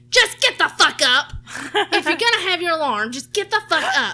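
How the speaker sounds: high, loud angry holler; exasperated retold shouting; pissed-off mom. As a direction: imitate a fed-up mother yelling at a snoozer. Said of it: "so pissed off all the time".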